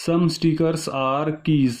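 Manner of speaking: "some stickers are keys" stretched out, each word separate; slow deliberate repeat. Shown as a demonstration. Teacher rereads the statement to check it, slow and deliberate.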